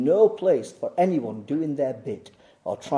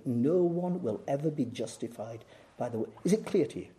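ordinary delivery

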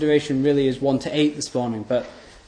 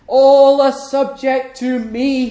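God's proclamation; 10 semitones higher, 5 dB louder, slower than usual. Acted. Voicing God's declaration.

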